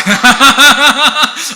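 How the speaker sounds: loud, high peal of laughter; hearty laughing. Real, not acted. Preacher retelling a friend's teasing to the congregation, laughing. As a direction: crack up at your own story.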